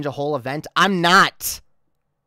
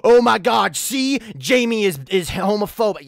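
higher, scandalized accuser imitation; mock outrage; 3 semitones higher than usual. Imagined critic twisting the streamer's slur, mock outrage. Mimics someone twisting her words.

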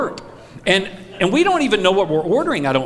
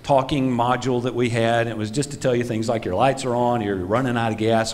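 flat customer service; 6 semitones lower, 3 dB quieter than usual. Retold or imitated. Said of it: "And they just said".

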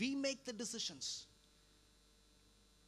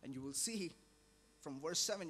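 ordinary talk, nothing marked

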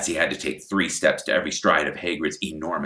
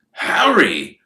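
panting; 9 semitones higher, 8 dB louder than usual. Acted. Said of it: "Mister Weasley panted".

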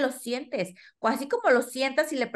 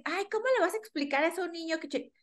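mock objection; 6 semitones higher, 5 dB quieter, faster than usual. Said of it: "Y, y vas a decir".

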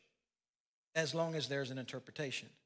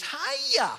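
put-on much higher, louder squeaky toddler hi; toddler greeting; 9 dB louder than usual. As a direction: chirp it out like a tiny kid. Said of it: "He goes".

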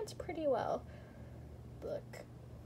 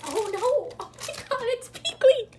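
excited squeal; 10 semitones higher, 8 dB louder than usual. Real, not acted. Finds rare figure in box.

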